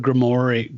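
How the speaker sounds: "grimoire" said grim-MOR-ee; doubtful alternative pronunciation. Shown as a demonstration. Reporting a version once heard, "grimoire" as grih-mor-ee. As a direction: say "grimoire" in three beats, ending in ee. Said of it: "I've also heard grimoire".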